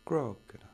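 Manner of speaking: "Crocodile." stressed CROC-odile, loud; first-syllable stress. Shown as a demonstration. Teacher showing stress from Roald Dahl words, CROC-odile stressed first. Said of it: "l'accent est sur la première syllabe".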